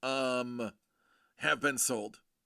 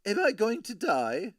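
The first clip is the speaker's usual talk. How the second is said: a hint of the Travelocity gnome, laughing; 4 semitones higher than usual. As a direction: squeak the gnome's line through a laugh. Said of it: "like the gnome from the Travelocity commercials".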